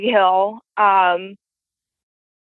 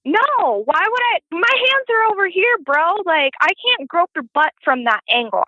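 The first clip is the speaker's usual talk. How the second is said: indignant denial; 8 semitones higher than usual. Retold or imitated. Retold defending herself.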